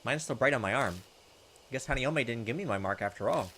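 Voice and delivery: usual speaking voice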